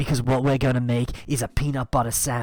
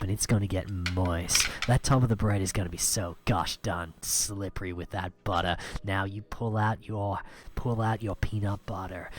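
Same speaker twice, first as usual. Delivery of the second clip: ordinary delivery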